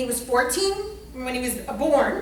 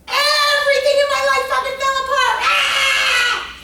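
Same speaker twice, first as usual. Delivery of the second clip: a high, loud outburst with a cry of "Ah!" at the end; shouting; 11 semitones higher, 7 dB louder than usual. Real, not acted. Tells the audience her whole life fell apart, shouting. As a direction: let it burst out of you.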